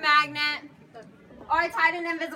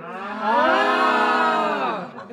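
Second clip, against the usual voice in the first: crowd cheer; 6 semitones lower, 6 dB louder than usual. Shown as a demonstration. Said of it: "and the crowd goes".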